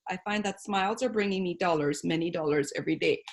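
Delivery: soft quoted reading from the book; reading aloud. Acted. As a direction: read the passage quietly, like a quote.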